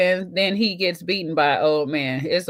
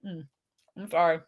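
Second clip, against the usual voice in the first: apologising while chewing; swallowing the last bite; mouth full; 3 semitones lower, 5 dB quieter than usual. Real, not acted. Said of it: "That's our last bite".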